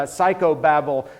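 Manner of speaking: ordinary delivery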